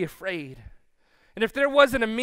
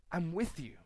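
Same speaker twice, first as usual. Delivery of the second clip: soft assurance; 4 semitones lower, 9 dB quieter than usual. Acted. Tender assurance in sermon.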